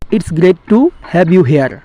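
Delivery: very slow, careful English; slow model sentence. Shown as a demonstration. Teacher giving the English translation, slow and deliberate. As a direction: lay each word out slowly. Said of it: "এইভাবে বলতে পারো".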